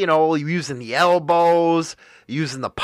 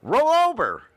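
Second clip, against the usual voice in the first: mock incredulous echo; 8 semitones higher than usual. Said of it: "this was one of the first time".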